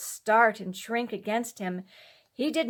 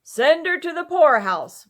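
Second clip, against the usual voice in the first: loud, higher mocking call; the villagers' callous shout to send her away; callous crowd cry; 7 semitones higher, 10 dB louder than usual. A narrator's reading.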